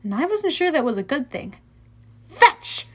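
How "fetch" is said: higher, louder call to a dog; calling a command. A narrator's reading. Percy throws a stick for Cerberus, calling it out. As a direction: call it out sharply, voice jumping up.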